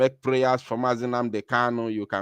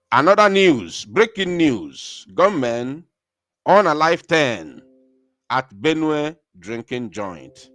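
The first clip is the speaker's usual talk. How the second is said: breaking news announcement; 4 dB louder than usual. Acted. Host breaking news of gunmen to listeners, dramatic announcement. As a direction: announce it loudly, stretching the headline words.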